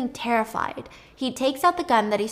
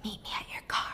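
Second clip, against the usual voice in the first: soft unvoiced whisper; 13 dB quieter, faster than usual. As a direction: breathe it so no one hears. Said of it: "Connie whispers at him".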